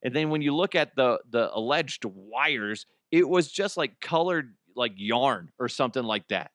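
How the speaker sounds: ordinary talk, nothing marked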